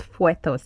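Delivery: "fetus" said as "fwe-toss"; wrong pronunciation. Shown as a demonstration. Teacher rejecting the old school error, "fwe-toss". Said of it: "it is not fetus".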